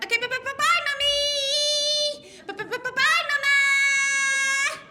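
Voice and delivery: put-on high, loud, sing-song five-year-old; child voice. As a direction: wail a drawn-out goodbye like a small child. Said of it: "they were like".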